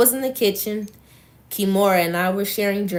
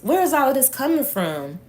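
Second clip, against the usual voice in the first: higher-pitched, mildly baffled question; confused; 7 semitones higher than usual. A narrator's reading. Sean asking where this comes from, mildly confused. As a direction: read his question with puzzled, raised pitch. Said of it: "He looked confused as he asked".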